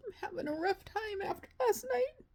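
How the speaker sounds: high, tearful voice breaking as she vents; crying. Real, not acted. Vlogger tearfully telling viewers about her rough night, crying. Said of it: "I didn't mean to get emotional".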